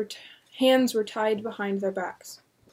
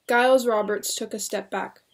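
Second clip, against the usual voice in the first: ordinary delivery, nothing marked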